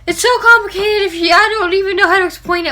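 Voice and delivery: high, excited rush of words; gushing. Real, not acted. Fan gushing to viewers about a book, high and excited. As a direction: gush about it breathlessly.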